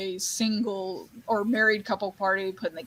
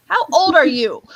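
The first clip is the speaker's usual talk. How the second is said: loud, high-pitched disbelief; mock incredulous; 5 semitones higher, 9 dB louder than usual. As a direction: exclaim it, acting astonished. Retelling own astonished reaction.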